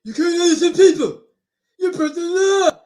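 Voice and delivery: lightly voiced tough guy; Stallone impression. Telling people off in a hint of Stallone. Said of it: "my Sly Stallone impression".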